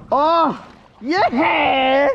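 a burst of joy at the catch; excited whoop. Real, not acted. Wife cheers as the snapper comes up, excited whoop.

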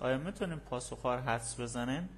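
normal talking voice

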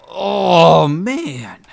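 loud, dismayed cry. Real, not acted. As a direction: groan out in dismay. Sees something went wrong.

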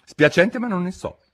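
mildly flustered, high-pitched; slightly panicked apology; rather alarmed. A narrator's reading. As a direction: excuse yourself, a touch alarmed. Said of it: "disse Alice piuttosto allarmata dall'invito".

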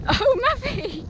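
high, loud startled call to the horse; startled exclamation. Real, not acted. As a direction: burst out high, caught off guard. Horse acts up, rider exclaims.

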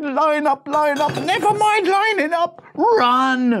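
high, loud shout breaking into run; a teacher losing control, then yelling; panicked teacher. Acted. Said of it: "The teacher said".

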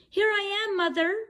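high calling chick voice; calling baby bird. Acted. Bird calling to the plane, high calling chick. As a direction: call up to the sky.

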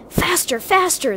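high-pitched urgent grumble; grumbling. A narrator's reading. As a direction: egg the others on, grumbling high. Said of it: "they grumbled".

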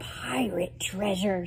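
hushed, secret whisper of a find; excited whisper. Acted. Katie showing the women her find, whispered. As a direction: breathe it like a secret. Katie reveals found treasure.

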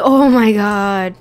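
loud, drawn-out cry of dismay. Real, not acted. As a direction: let the shock drag out loudly. Cow is accidentally killed.

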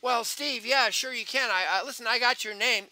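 high, animated phone voice; voice brought up. Shown as a demonstration. Recruiter mirroring Steve on the phone, voice brought up. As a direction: come up to match an upbeat listener. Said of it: "You need to come up".

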